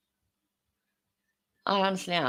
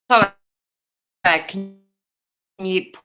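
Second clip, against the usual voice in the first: ordinary talk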